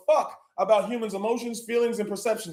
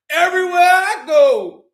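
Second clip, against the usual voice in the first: shrieking laugh into a high, loud cry; laughing shout; 10 semitones higher, 7 dB louder, slower than usual. Real, not acted. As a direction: lose it laughing and yelling. Ecstatic outburst on stream.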